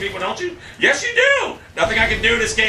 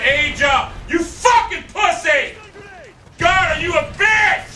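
furious shout; 9 semitones higher, slower than usual. Real, not acted. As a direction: stretch out the insult in real fury. Raging at Asia mid-game.

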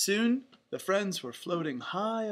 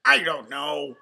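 loud, moping cartoon duck; Donald; 2 semitones lower, 9 dB louder than usual. A narrator's reading. Duck unsure about joining his friends, moping quacky voice. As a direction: grumble it out like a sulky duck. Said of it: "Donald moped".

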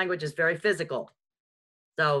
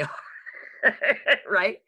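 ordinary talk, nothing marked

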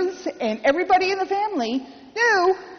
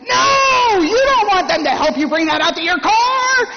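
much higher, louder gruff shout; grandfather yelling; 7 semitones higher, 5 dB louder than usual. Retold or imitated. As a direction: bellow it like a proud old man forbidding help. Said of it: "My grandfather is yelling in the back of my head".

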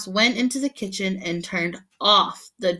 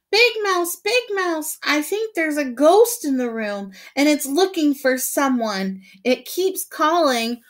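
much higher, louder scared yell; frightened yell; 7 semitones higher, 5 dB louder than usual. Acted. Little mouse terrified of a ghost, high frightened yell. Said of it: "feeling very frightened, he yelled".

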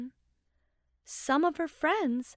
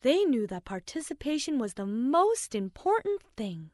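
plain reading voice

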